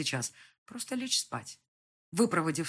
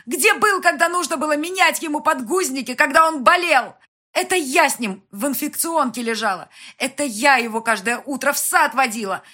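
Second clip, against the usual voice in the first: high, very loud torrent of reproach; furious tirade; 9 semitones higher, 15 dB louder than usual. A narrator's reading. Mother berating absent father of her son, furious tirade. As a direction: rage at him without letting up.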